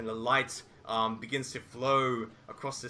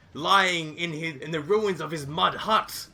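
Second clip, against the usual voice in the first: usual speaking voice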